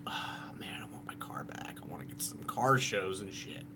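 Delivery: whispered longing to himself; imitated whisper. Vlogger voicing his inner craving for his car, whispered. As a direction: breathe it out like a private wish. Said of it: "So I'm like".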